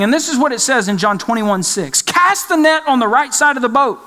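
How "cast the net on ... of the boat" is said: raised, higher voice calling out; mock yell from shore. Man on the shore calling to fishermen, mock yell. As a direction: call it out as if across water. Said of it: "guy on the shore that's yelling".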